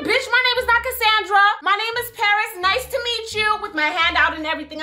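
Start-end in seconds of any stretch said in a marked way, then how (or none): 0.1-3.6 higher, cool sassy introduction; sassy correction. Retold or imitated. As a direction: set her straight with attitude. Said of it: "I said".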